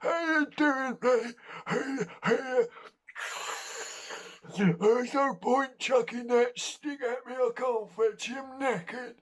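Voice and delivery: put-on silly high-pitched old dog voice, cockney and breathless; high, puffed-out cockney pet voice. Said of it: "he's breathless and cockney".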